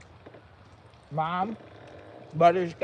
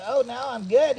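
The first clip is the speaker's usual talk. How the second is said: mock relief; 7 semitones higher, 7 dB louder than usual. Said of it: "I'll be like".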